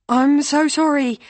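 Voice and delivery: flustered apology. A narrator's reading. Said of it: "She stumbles over the words".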